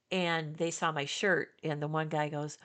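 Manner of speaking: ordinary talk, nothing marked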